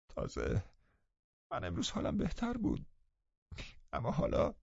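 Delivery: high, very soft, halting complaint; choking up. A narrator's reading. Sickly Linton bemoaning his state, voice choking up. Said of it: "و بغضش گرفت".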